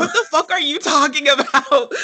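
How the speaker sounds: high, laughing incredulous question; laughing disbelief. Real, not acted. Co-host repeats the outrage, laughing in disbelief.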